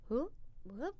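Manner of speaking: very soft, blown-out whoop; calming breath sounds. Retold or imitated. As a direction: puff softly to steady yourself. Acts out steadying herself before soothing child.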